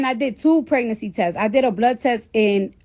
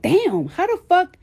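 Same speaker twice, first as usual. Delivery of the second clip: stunned disbelief; 8 semitones higher than usual. Retold or imitated. Shocked at the big baby.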